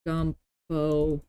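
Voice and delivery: ordinary talk, nothing marked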